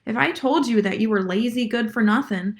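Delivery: normal talking voice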